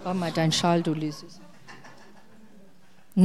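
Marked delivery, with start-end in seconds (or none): 0.0-1.4 hushed aside. Acted. Acted aside during demo.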